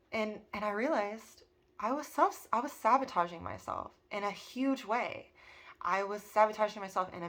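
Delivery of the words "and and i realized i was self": ordinary talk, nothing marked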